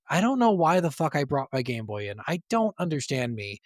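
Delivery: ordinary talk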